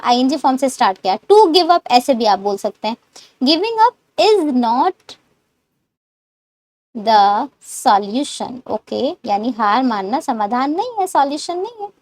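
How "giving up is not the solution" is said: drawn-out, very slow delivery; slow model sentence. Shown as a demonstration. Teacher dictating the answer slowly to learners. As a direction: stretch every word for the class.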